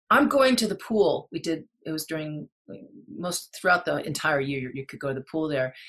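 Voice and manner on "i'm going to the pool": higher, louder retold declaration; re-enacted determined exit line; insistent announcement. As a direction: announce it firmly, pitched up. Acted announcement of defiant escape.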